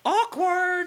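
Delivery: very high drawn-out cringe; secondhand embarrassment. Real, not acted. Cringing at the love scene.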